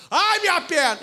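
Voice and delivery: high, hammed-up cry of pain. Other warrior on the ground crying out over his leg. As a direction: moan over the wounded leg. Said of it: "O outro".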